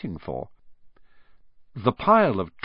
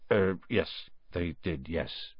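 much softer, trailing away; embarrassed mumble fading; 4 semitones lower, 8 dB quieter, slower than usual. Acted. Hagrid admits the expulsion, fading to a sheepish near-silence. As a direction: shrink into it, ashamed.

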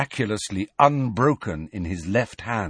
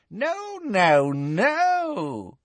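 high, thrilled witchy voice; delighted, stop them eagerly; transported; 11 semitones higher, much slower than usual. A narrator's reading. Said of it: "She looked transported".

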